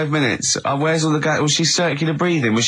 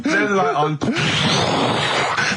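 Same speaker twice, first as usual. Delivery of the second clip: mock advert line; 4 semitones higher, much slower than usual.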